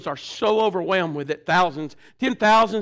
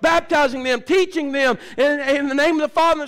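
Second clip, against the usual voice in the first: forceful, rhythmic pulpit preaching; preaching at full force; 5 semitones higher than usual. Real, not acted. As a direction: drive each question home to the congregation.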